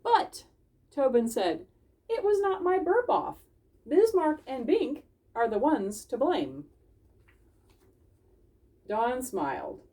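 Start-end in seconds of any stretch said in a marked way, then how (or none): 0.0-0.5 very high, loud but; high squeaky protest. Acted. Tobin protests. 2.1-6.7 very high, pleading defense; high squeaky voice. Acted. Tobin protests blame.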